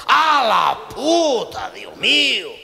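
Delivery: high, loud, drawn-out cry of shock; doctor shouting in mock horror; horrified doctor. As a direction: recoil at the sight. Doctor sees the patient's backside.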